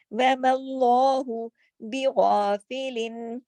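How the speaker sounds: slow tajweed chant; chanted verse; Quran recitation. Shown as a demonstration. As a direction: recite slowly and measured.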